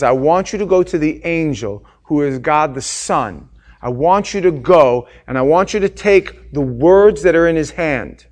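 loud, insistent divine command; commanding God voice. Acted. God the Father sending John to the angel, booming. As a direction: order him to go, booming.

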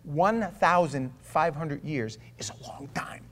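"one thousand five hundred years" whispered slowly; whispered take. Shown as a demonstration. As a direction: whisper the same figure to make it land.

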